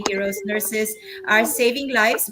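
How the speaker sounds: normal talking voice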